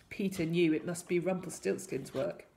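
usual speaking voice

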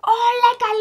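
high, loud delight at the food; bright food-lover's squeal. Real, not acted. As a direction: gush over the tasty dish. Delighted at lemon pasta.

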